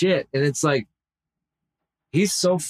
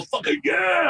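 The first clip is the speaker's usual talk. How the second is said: excited win yell; 13 semitones higher, slower than usual. Retold or imitated. Imitating his friend cheering a roulette win, excited yell. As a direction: shout it like you just hit big. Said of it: "And he was all".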